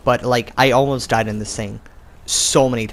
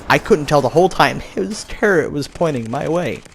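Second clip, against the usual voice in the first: normal talking voice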